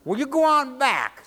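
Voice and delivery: high, very loud taunt; triumphant yell. A narrator's reading. Fisherman yelling after the sunken serpent, triumphant. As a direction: holler it after a beaten foe.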